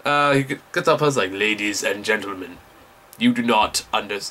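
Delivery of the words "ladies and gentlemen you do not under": lower, measured imitation of a Russian teacher; lightly voiced Russian lecturer; Russian accent. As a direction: address the room in a put-on Russian accent. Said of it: "he talks with a thick Russian accent".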